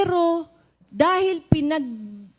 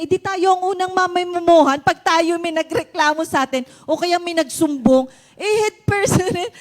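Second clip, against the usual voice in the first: urgent; 6 semitones higher, 7 dB louder than usual. Retold or imitated. Urgent explaining to Tita Joy.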